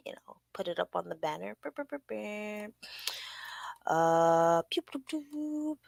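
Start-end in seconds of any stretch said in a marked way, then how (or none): 1.6-2.7 soft rolled "brr" sound effect; imitated banner noise. Host putting it up on the banner, soft playful noise. 4.7-5.8 soft, high, drawn-out pew noises; slow little high-pitched pews; playful sound effect. Pretend. Host filling time while putting up the banner, high playful noise.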